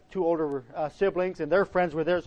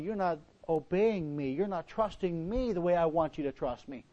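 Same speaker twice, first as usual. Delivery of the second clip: softer, measured imitation of the Lord; gentle reproof; 8 dB quieter than usual. The Lord answers the complainers, gentle reproof. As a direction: lightly voice a quiet, patient correction.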